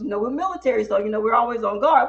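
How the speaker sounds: ordinary talk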